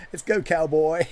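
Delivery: hammed-up American country twang; country accent. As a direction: put on a jokey country accent. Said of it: "terrible American country accent".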